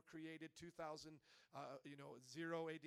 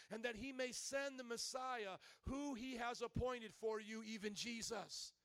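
slow, raised preaching voice; Peter preaching; 4 semitones higher, 5 dB louder, slower than usual. Acted. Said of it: "Here's Peter preaching".

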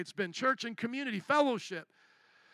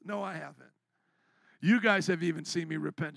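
normal talking voice

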